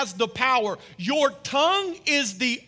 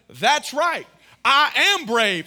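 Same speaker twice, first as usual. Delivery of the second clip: bold declaration; 3 semitones higher, 7 dB louder than usual. Retold or imitated. Voicing daughter's bold answer.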